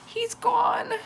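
very high, loud acted wail; between sobs. A narrator's reading. Sophie tells her parents he's missing, wailing sobs. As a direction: wail it as a sobbing child. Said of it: "she said between sobs".

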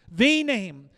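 "The" hit hard as a loud "thee"; stress on THE. Shown as a demonstration. Preacher repeating the Greek emphasis, "The" punched as "thee". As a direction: punch "The", pronounced "thee", before "name". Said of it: "In the Greek, it emphasizes the name".

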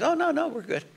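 high, light dismissive "no, no"; a hint of an airy brush-off; breezy brush-off. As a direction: wave it off lightly. Imitating other person's breezy reply.

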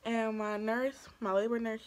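ordinary delivery

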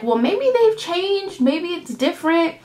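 mock hopeful reasoning; wishful second-guessing. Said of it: "you're like".

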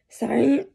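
loud, rough throat clearing between words; real throat clearing from a cold, then an apology. Real, not acted. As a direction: clear a cold-clogged throat hard, then a quick sorry. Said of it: "Sorry, I'm getting a cold".

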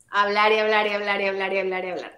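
fast, monotone run of repeats; droning on and on, quickly; nonstop rattling. Acted. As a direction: rattle it off without a breath. Mimics endless talking in long commercials.

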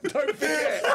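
ordinary talk

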